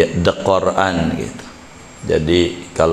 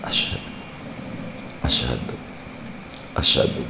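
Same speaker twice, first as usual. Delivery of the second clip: softly murmured "Asyhadu", much quieter; quiet, low prayer mumble; murmured recitation; 6 semitones lower, 10 dB quieter, much faster than usual. Shown as a demonstration. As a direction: mumble it low like a follower praying under his breath. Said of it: "Jadi makmum tuh enak".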